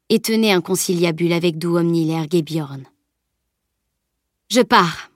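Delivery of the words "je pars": firm announcement, first word punched; announcing. A narrator's reading. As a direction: announce it to the group, a little louder. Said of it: "annonça Camille".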